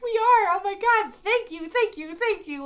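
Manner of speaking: high-pitched, loud, gushing thanks; overwhelmed squeal. Retold or imitated. Fans gushing thanks to staff, high and squealing. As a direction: squeal out thanks, barely holding it together.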